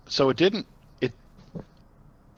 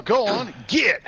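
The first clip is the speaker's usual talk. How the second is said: shouting; 8 semitones higher, slower than usual. Pretend. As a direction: shout it off like a stray dog. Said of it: "I wanna yell".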